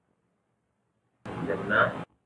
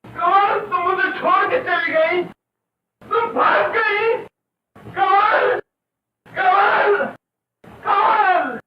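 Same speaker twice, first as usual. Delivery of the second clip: high, loud, desperate wailing; anguished cry; 7 dB louder, slower than usual. Acted. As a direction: cry out for the woman who left. Deserted man cries out for Kamal. (दर्द से चीख़कर पुकारना)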